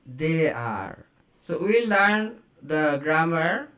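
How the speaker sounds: normal talking voice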